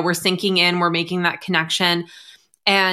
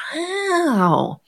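high, drawn-out impressed wow; 11 semitones higher than usual. Retold or imitated. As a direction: react like the client just did great work. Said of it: "And I'm like".

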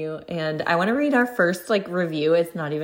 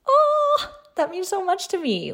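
a grateful host thanking listeners, voice soaring; touched gush; 12 semitones higher than usual. Real, not acted. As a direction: gush with real gratitude.

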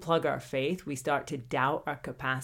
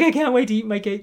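eager excitement; 4 semitones higher, 8 dB louder, faster than usual. Pretend. Eager to eat the cake.

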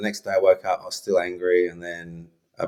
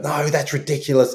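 dismissive retort; 7 semitones higher than usual. Retold or imitated. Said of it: "I would have given it that".

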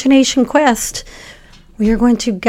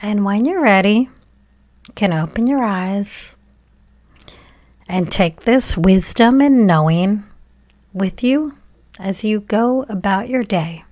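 guided meditation; 2 semitones higher than usual. Acted. Podcast host guiding children to thank heart, brain and body, guided meditation. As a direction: guide children gently through it, leaving room to breathe.

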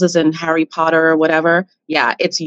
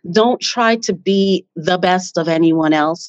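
ordinary talk, nothing marked

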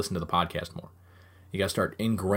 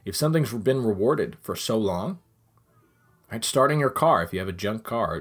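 ordinary talk

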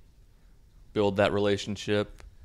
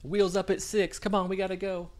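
high-pitched put-on girlfriend voice; Vanessa, mock mad; 11 semitones higher than usual. Girlfriend mad out of nowhere, high mock annoyance. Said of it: "Vanessa just gets mad out of nowhere".

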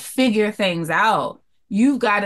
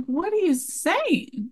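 stunned disbelief; 11 semitones higher, 4 dB quieter, slower than usual. Retold or imitated. Daughter shocked by mother.

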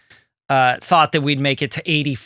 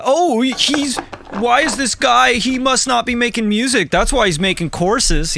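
high-pitched mock judgmental voice; judgy internet commenter; 9 semitones higher than usual. Host mimics online critics judging a producer who sells courses, judgy. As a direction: sneer in a raised, put-on voice like an online critic.

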